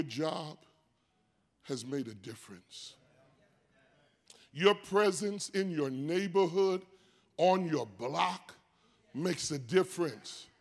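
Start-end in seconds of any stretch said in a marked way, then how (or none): none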